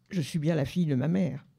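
ordinary talk

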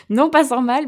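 laughing. Real, not acted. Raconte en riant.

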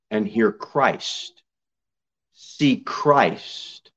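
normal talking voice

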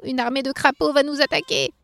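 high-pitched alarm; acted squeaky panic; panicked elf cry. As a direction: cry out like a frightened little elf. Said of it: "crièrent les lutins".

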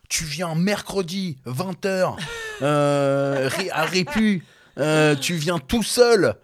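high, slow, drawn-out mock menace; movie gangster threat. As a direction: play the film villain setting a meeting. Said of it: "c'est vraiment le truc d'un film".

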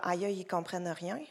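low, soft worried aside to oneself; acted head-voice grumble, hushed and low; muttered inner thought. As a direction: voice a private thought under your breath, amused. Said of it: "passer la soirée dans ma tête".

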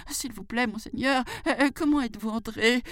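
high, hesitant pleading question; timid jackal. Pretend. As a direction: ask it meekly, high and stammering. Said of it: "faisant semblant de trembler de peur". (chacal craintif, voix aiguë)

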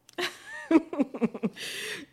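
high burst of laughter; nervous laugh. Real, not acted. Mother telling her birth story, bursting into high laughter. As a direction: laugh out the tension.

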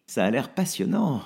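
dry, mocking enthusiasm; flat fake interest; sardonic. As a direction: sneer the word passionnant. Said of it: "dit Béat sardonique".

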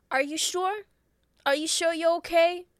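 pressing questions from a hammed-up gangster; Joe Pesci. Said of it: "I imagine Joe Pesci".

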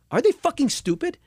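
high, incredulous angry outburst; a frustrated artist venting alone at night; pissed-off outburst. Retold or imitated. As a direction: fume at the labels, pitch way up. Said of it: "I was so pissed off".

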